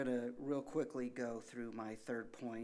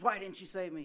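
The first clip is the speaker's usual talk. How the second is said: higher, louder indignant complaint; drowned man complaining to the Lord in heaven; 6 semitones higher, 6 dB louder, faster than usual. Retold or imitated. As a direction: demand an answer, offended. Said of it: "so indignant to the Lord".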